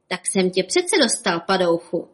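usual speaking voice

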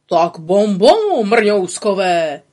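slow, loud exclaiming; jubilant. A narrator's reading. Pytlík rejoices at the tiny beetles, slow loud jubilation. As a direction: cheer the little ones on delightedly. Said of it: "jásal Pytlík".

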